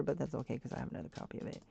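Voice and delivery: ordinary talk, nothing marked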